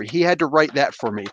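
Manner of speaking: normal talking voice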